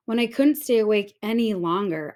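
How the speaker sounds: ordinary delivery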